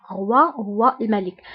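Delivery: normal talking voice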